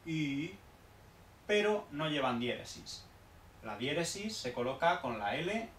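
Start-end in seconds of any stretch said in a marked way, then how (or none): 0.1-0.5 I said as a rounded ü; ü vowel. Shown as a demonstration. Teacher shows ü after j, q, x. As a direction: round the lips on I. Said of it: "se pronuncian como esta I".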